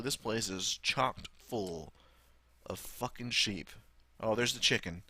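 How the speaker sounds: ordinary delivery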